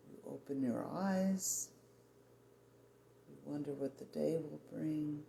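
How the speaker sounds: normal talking voice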